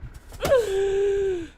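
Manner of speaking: high laugh. Real, not acted. Laughing at his own point.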